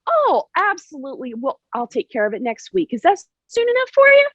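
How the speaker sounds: high, sugary overly agreeable voice; mock the neighbor's fake friendliness, pitched high; fake nice neighbor. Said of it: "the facade they put on".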